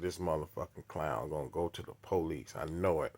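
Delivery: a quiet suspicious inner voice; muttered thought. Retold or imitated. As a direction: grumble it under your breath.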